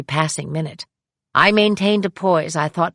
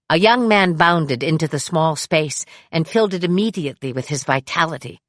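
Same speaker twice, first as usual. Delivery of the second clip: usual speaking voice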